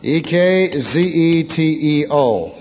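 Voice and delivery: slow, drawn-out letter by letter; measured dictation of single letters; spelling out. Real, not acted. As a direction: spell it slowly for note-takers. Spells out Greek term.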